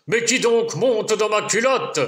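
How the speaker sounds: lumberjack shouting. Acted. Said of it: "le bûcheron hurle et crie".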